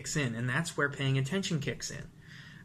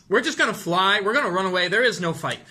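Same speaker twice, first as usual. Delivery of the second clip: louder, higher, hammed-up panic; mock panic; 4 semitones higher, 7 dB louder than usual. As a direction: play the brain screaming to run. Mocking the flight response.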